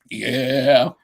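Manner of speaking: ordinary delivery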